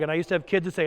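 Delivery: usual speaking voice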